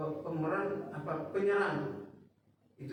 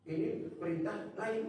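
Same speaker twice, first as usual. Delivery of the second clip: ordinary delivery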